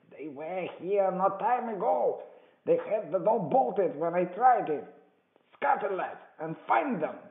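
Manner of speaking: high, loud, urgent command; shouted orders. A narrator's reading. Blind beggar sending his gang to hunt, urgent shout. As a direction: drive the men on with loud high cries.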